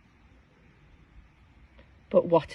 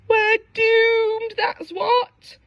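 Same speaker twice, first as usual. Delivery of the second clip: high crying despair; 12 semitones higher, much slower than usual. Acted. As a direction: wail of doom. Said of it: "cried Cucumber".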